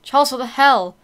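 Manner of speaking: ordinary talk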